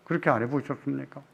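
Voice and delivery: ordinary talk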